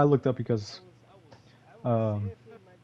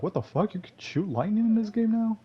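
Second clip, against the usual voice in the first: surprised; 10 semitones higher than usual. Retold or imitated. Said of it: "I was like".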